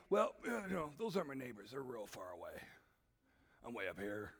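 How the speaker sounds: low, distant voice of someone far above; a lofty voice looking down from far away; aloof God voice. Retold or imitated. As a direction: play it lofty and far removed, voice dropped low. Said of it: "That's how he talks".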